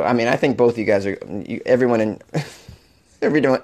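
ordinary delivery

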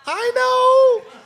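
high, wailing fake sob; hammed-up crying whine; mock crying. Mock grief over hungry ghosts.

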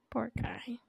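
soft pitying whisper. Real, not acted. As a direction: whisper it with pity. Mourning the lonely whale.

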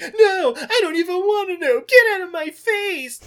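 high, loud mock tantrum; Jesus. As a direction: wail a hammed-up angry refusal. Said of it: "And Jesus is like".

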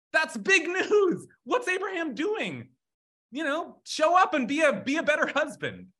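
high-pitched, exasperated scolding; angry God. Acted. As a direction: berate an absent husband in mock outrage. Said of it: "I'm angry because Abraham never told you".

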